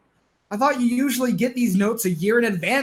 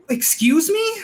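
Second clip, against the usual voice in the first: high, indignant excuse me; shocked; 8 semitones higher, 3 dB louder than usual. Acted. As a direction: balk in disbelief. Shocked at the offer.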